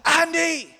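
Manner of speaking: full, high-pitched holler; really loud shout. Retold or imitated. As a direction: yell the name so it carries across a parking lot. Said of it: "So I shouted really loud".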